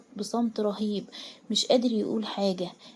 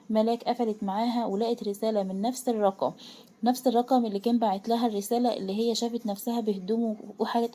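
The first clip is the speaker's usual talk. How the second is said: usual speaking voice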